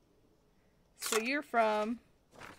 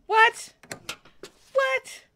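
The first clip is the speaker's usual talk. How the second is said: high, loud yelp of disbelief; startled, piercing outburst; incredulous; 13 semitones higher, 10 dB louder than usual. Real, not acted. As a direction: squawk it in disbelief. Reacts in disbelief to game.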